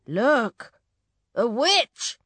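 high, loud warning cry; alarmed calling out. A narrator's reading. Parent spotting the witch, alarmed cry. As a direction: point out danger in alarm.

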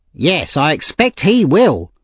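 high, slow, scornful lady; angry. A narrator's reading. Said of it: "said the lady angrily".

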